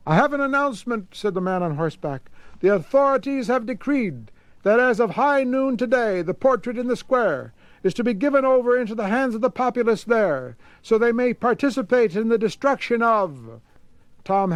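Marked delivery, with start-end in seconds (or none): 0.1-1.1 high, fast proclamation; official announcement. Acted. Man on horseback announces. 2.6-13.6 high, carrying herald read; formal decree read out, pitched high; official proclamation. Acted.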